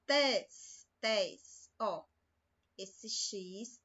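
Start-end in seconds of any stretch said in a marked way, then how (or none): 0.1-1.7 "Tes" pronounced with a hissing S ending, isolated; isolated sound. Shown as a demonstration. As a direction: drill the syllable "Tes" so the S is heard. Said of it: "Tem som de S". (pronunciar "Tes" com o S bem marcado)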